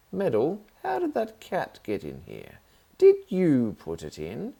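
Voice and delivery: pin the blame on him; suspicious aunt. Acted.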